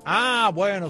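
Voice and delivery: usual speaking voice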